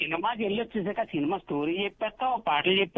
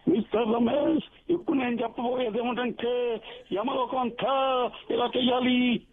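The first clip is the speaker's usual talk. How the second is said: film-dialogue mimicry, pitched up; theatrical, higher voice with stretched phrase ends; NTR; 4 semitones higher than usual. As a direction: declaim it like an old movie hero. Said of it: "Yamagola NTR cinema చూసి".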